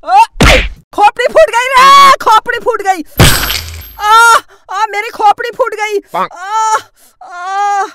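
comic wail over a cracked skull; hammed-up pain cry. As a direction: cry out that the head split. Head hits wall, cries. (सिर फूटने पर नाटकीय चीख)

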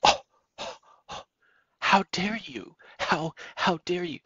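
mock offended atheist. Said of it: "And then they might say".

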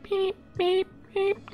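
high electronic beep imitation; beeping alarm. Shown as a demonstration. GM mimicking the biomed alarm, high beeps. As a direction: chirp it like a monitor.

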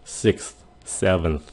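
sixth, seventh slow and soft, air flowing after th; breath continues. Shown as a demonstration. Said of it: "mình không có chấm dứt cái hơi đó".

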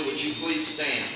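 normal talking voice

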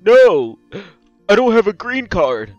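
loud, high exclamation with a gasp; distressed outburst. Acted. Stranded leprechaun admitting no green card, distressed. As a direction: cry it out in exasperation.

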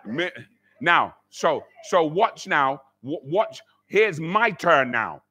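ordinary talk, nothing marked

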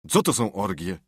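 Huck's different voice, puzzled; puzzled boy. Acted. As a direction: ask it naively.

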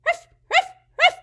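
high bark, ruff ruff; playful dog imitation; yappy dog bark. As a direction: play a small yapping dog.

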